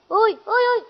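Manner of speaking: agudo ui ui de dor; gemido de dor. Acted. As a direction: gema como quem apanhou. Apanhou, gemendo de dor. (gemidos agudos de quem levou um soco)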